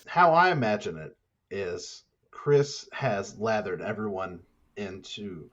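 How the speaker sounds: normal talking voice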